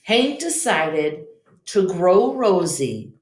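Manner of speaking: usual speaking voice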